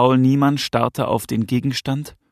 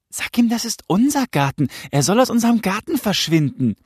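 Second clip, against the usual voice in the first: put-on high-pitched, insistent boy's demand; agitated son; 10 semitones higher, 3 dB louder than usual. Son to his father about the intruder, agitated demand. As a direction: demand it urgently in the son's higher voice.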